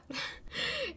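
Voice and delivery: high disbelieving laugh; a short laugh of disbelief; incredulous laugh. Real, not acted. Laughs at the weird repost.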